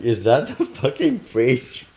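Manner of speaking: mock exasperation. Man kept awake by his fridge, drawn-out disbelief. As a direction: draw it out, appalled by the noise.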